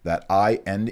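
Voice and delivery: usual speaking voice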